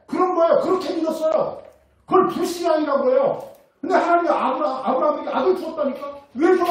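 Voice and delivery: loud, high emphatic preaching; fervent sermon voice, pressing each line. Acted. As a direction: hammer the point home from the pulpit. Preaching at full force.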